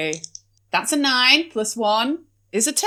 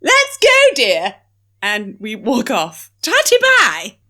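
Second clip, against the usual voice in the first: posh lady voice; 3 semitones higher than usual. Acted. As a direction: sweep off like a toff. Posh character breezes off.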